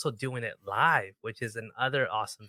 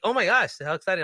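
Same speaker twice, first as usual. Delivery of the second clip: retold excitement; 7 semitones higher, 5 dB louder than usual. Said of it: "And she's just like".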